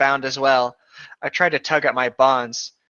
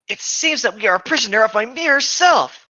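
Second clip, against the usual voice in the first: higher, louder earnest line; lightly voiced hero; 9 semitones higher, 4 dB louder than usual. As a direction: declare it grandly. Character line in read fanfic.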